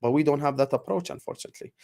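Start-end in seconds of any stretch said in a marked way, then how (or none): 1.1-1.7 barely voiced, breathed out; whispered aside. Real, not acted. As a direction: drop to a whisper. Sad aside after team criticism.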